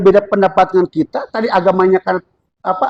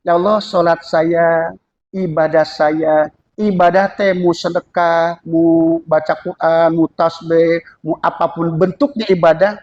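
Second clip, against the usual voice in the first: surrendering prayer; 6 semitones lower, slower than usual. Shown as a demonstration. Preacher voices a surrendering prayer.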